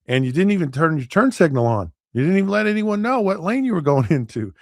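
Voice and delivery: rising, high-pitched acted reproach; exasperated scolding. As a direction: pile on the offenses in disbelief. Officer lists the driver's offenses.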